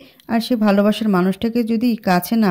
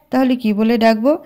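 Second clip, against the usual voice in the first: usual speaking voice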